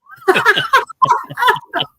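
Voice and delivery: loud high burst of laughter; big laugh. Real, not acted. Laughing after apology.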